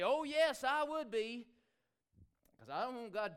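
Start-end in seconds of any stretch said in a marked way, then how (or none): 0.0-1.5 high, loud boastful claim; playfully imitating a proud boast; mock boast. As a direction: puff up like a braggart. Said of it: "boast and say".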